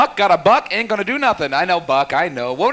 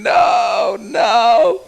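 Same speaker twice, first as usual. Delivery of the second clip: higher, pleading audience protest; mock audience groan; 7 semitones higher than usual. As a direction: ham up the crowd begging him to stop. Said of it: "You're all going".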